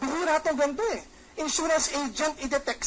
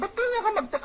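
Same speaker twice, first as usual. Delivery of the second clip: much higher, mock-offended friend; a friend reading caps as anger, high and mock-hurt; mock annoyed question; 8 semitones higher, faster than usual. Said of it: "Alam mo, galit".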